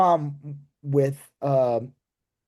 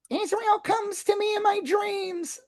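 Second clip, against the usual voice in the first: very high, slow mock-spooky voice; eerie supernatural quote. Mocking a candidate's weird line, eerie high voice. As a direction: draw it out high like a dream apparition. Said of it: "like, vaguely supernatural or something".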